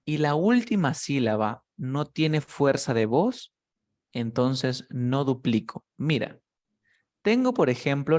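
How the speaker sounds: ordinary delivery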